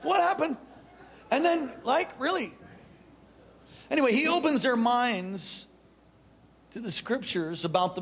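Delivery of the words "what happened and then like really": incredulous wonder. Real, not acted. Wondering about digesting fish.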